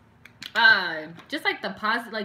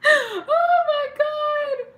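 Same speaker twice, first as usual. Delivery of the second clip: high, slow wheeze of laughter near tears; real helpless laughing, drawn out; laughing till crying; 8 dB louder, much slower than usual. Real, not acted. Laughing fit at her own joke.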